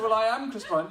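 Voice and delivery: high, loud comic insistence; exasperated self-quote. Retold or imitated. MP insisting to the lad on his own name, exasperated. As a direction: protest it at the top of your register. Retold argument, insists on identity.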